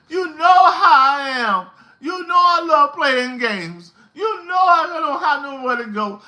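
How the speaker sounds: high-pitched imitation of a pleading, hollering man; mock crying holler. Retelling a man's emotional outburst at his woman, mock crying holler. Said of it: "He was crying, ... He was hollering".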